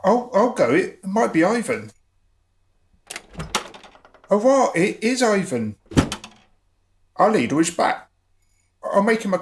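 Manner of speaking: rushing to the door hoping it's Ivan; eager excitement. Acted. Thorpe hurries to answer the knock, excited.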